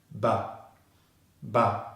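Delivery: "Ba" as a short, low schwa syllable; B plus schwa. Shown as a demonstration. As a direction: say "Ba" with a lazy neutral vowel. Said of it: "the B consonant sound and the schwa sound".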